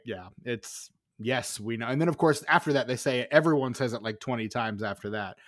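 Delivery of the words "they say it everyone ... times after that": ordinary delivery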